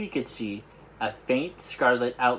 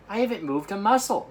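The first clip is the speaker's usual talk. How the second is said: protesting Sophie; 9 semitones higher, 4 dB louder than usual. Acted.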